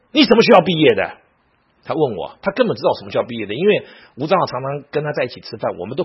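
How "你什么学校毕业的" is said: loud, high, table-thumping challenge; acted-out furious teacher's question; angry demand. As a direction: demand it accusingly, voice raised. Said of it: "后来他生气的把桌子一拍".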